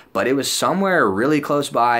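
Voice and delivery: ordinary talk, nothing marked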